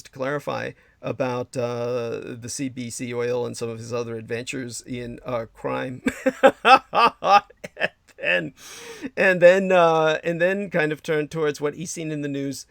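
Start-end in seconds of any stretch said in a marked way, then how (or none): 6.1-7.4 short high, loud laugh; quick burst of laughter. Real, not acted. Host teasing his helper about crime, laughing. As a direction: laugh at your own teasing.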